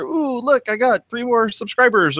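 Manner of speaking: playful high-pitched excitement; mock delight. Host imagining himself gaining readers, mock excited. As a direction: coo over a pretend little victory.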